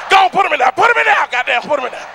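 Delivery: loud, high, impatient demand; overexcited donut order at full volume; greedy shout. Retold or imitated. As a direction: yell at the clerk to hurry and bag them. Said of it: "You be like".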